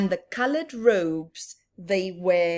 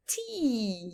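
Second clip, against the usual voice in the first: usual speaking voice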